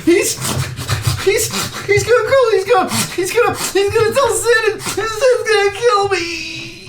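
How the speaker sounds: high, trembling panic; panicked kid. Acted. The beaten kid fears the bully will kill him. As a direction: babble in fear of getting hurt.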